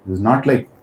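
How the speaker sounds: usual speaking voice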